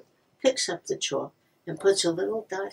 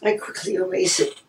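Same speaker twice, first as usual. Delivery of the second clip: usual speaking voice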